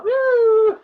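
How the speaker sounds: high, loud cheer; excited woo. Real, not acted. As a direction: whoop it up with joy. Cheering about birds.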